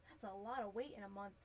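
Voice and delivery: ordinary talk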